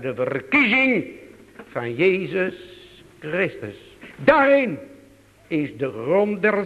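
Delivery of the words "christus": ordinary talk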